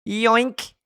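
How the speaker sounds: high, squeaky little yelp; squeaky toy voice. Acted. Squeaky toy grabs a spot.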